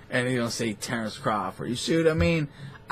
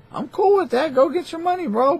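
much higher, lightly louder cheery tone; mock sing-song; 4 dB louder, faster than usual. Telling gamblers to go get their money, high mock cheer. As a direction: toss it off high and breezy. Repeats line in a new voice.

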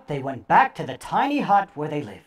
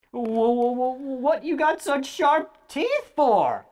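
put-on frightened boy; 7 semitones higher, much slower than usual. A narrator's reading.

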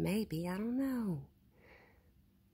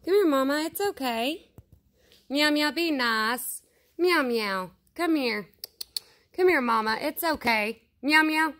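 higher, louder sing-song call to an animal; coaxing pet call; 4 semitones higher, 7 dB louder than usual. Real, not acted. Vlogger calling Mama and hushing the cats, coaxing loud call. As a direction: coax the shy animal closer, calling out.

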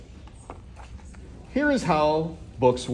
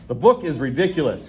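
usual speaking voice

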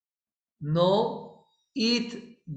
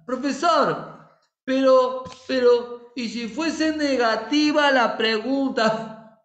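higher, slow pleading student voice butting in; eager student; 6 semitones higher, 4 dB louder, slower than usual. Acted. A student interrupting the professor, high and pleading. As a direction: interrupt the teacher like a keen pupil.

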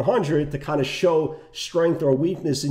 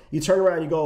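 normal talking voice

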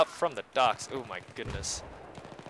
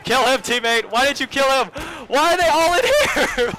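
very high, loud screech, climbing to a shriek; frantic yelling; 13 semitones higher, 10 dB louder than usual. Real, not acted. Gamer begging teammate to kill an enemy, frantic yelling. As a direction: screech desperately as it all goes wrong. Pleading with teammate while being killed.

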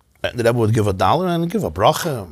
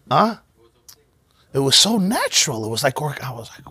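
ordinary delivery

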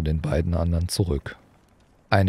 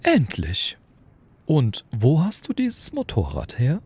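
put-on high, kindly old wizard, lightly curious; an amused old headmaster asking; Dumbledore; 8 semitones higher than usual. A narrator's reading. Dumbledore questioning Hagrid about the motorbike, amused.

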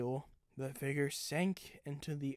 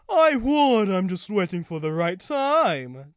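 loud, self-satisfied reply, starting high; big, swaggering comeback; chortling boast; 5 semitones higher, 14 dB louder than usual. A narrator's reading. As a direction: boast it loudly with a chortling air. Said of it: "chortled Fulcrum".

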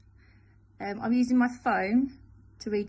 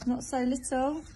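plain reading voice

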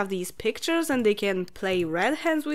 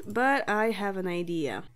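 ordinary talk, nothing marked

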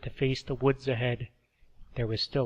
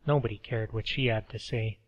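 ordinary delivery, nothing marked

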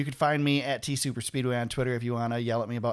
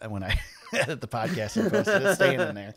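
amused refusal, breaking into a laugh; laughing defiance; 3 semitones higher, 4 dB louder than usual. Real, not acted. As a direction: laugh through the words, voice rising. Defiantly joking about keeping remark in.

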